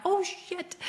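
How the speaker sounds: high, clipped-off startled curse; a sudden alarmed oh shit. Retold or imitated. Shocked to see the bee on her shirt, startled. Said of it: "and I'm like".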